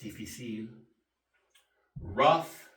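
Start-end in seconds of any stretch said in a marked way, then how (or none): 2.0-2.7 "rough" said ruff, G H like F. Shown as a demonstration. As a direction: say "rough" with an F sound at the end. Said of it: "sounds like an F".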